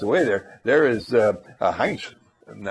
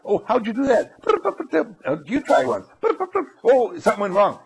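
put-on excited kid; 8 semitones higher than usual. Kids playing with computer.

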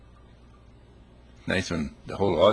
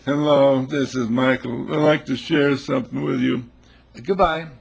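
imitating Michael's phone voice; 4 semitones higher, 5 dB louder than usual. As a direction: put on a cheesy telemarketer's pitch. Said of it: "And his voice".

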